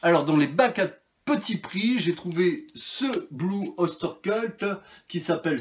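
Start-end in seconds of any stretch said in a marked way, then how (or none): 3.3-4.6 clumsy English accent. Real, not acted. Naming a bargain-bin find to viewers, in awkward English accent. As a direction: attempt the English band name with a bad French-tinged English accent. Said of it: "mon accent anglais est toujours aussi pourri".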